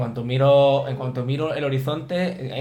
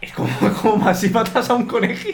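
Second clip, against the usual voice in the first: laughing; 8 semitones higher than usual. Real, not acted. Disgust at chipmunk voices, laughing.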